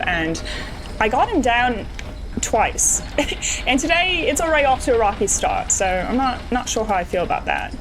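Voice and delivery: hammed-up amateur British accent; bad British accent. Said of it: "a really bad British accent that I'm doing".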